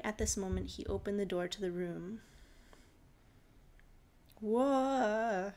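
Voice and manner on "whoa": loud startled bellow. Acted. Father finds man kissing his daughter, bellowing. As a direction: roar it from the doorway. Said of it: "he bellowed".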